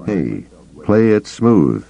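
usual speaking voice

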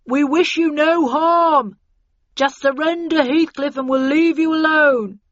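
slow, high, loud call; shouted negotiation from outside the house; bullhorn announcement. A narrator's reading. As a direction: project demands as through a bullhorn. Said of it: "voice on a bullhorn from outside".

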